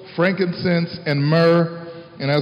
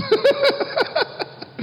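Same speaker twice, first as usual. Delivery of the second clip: laughter. Real, not acted. Laughing at joy-sucking times.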